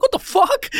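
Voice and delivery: put-on high, stunned exclamation from an old-timer seeing FaceTime; a startled old man squawking at new tech; great-grandfather's shock. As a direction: throw it up in pitch, flabbergasted. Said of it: "he'd be like".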